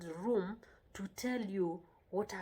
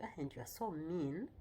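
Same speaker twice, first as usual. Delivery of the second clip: low, softer accusation rising at the end; mock reproach; 3 semitones lower, 7 dB quieter than usual. Shown as a demonstration. Somebody telling you that you are mean, mock reproach. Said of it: "somebody tells you".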